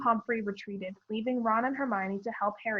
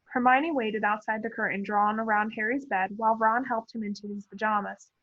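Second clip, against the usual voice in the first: ordinary delivery, nothing marked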